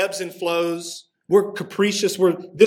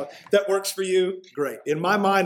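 ordinary talk

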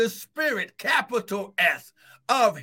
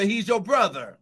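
ordinary talk, nothing marked

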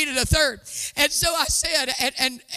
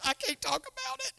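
high, much softer choked voice; high hushed whisper; 10 semitones higher, 10 dB quieter than usual. Retold or imitated. Telling her best friend she can't speak, in a high hush. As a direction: squeak it out, too moved to speak. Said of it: "I was like".

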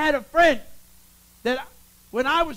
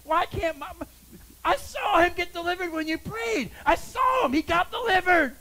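imitating a distraught mother, pitched high; high, insistent wail of a retold mother; tearful pleading; 6 semitones higher than usual. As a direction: plead upward in mock-crying desperation. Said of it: "she would come to me crying".

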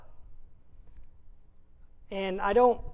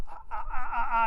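laughing ah ah in a hurry; much faster than usual. Retold or imitated. Retelling excitement while folding clothes, laughing. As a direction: laugh it out while typing fast. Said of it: "and I'm like".

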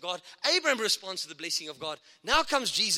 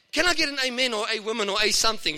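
louder fired-up call for an amen; getting excited; 3 semitones higher, 7 dB louder than usual. Real, not acted. Preacher asking the congregation for an amen, excited and louder. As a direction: whip the crowd up for an amen. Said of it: "Sorry, I'm getting excited".

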